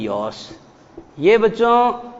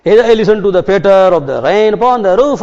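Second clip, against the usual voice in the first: slow, louder recital; poem recitation; 3 semitones higher, 6 dB louder, much slower than usual. Shown as a demonstration. Teacher reading a rain poem to the class, slow and loud. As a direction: read the line slowly and fully. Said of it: "जरा ध्यान देना".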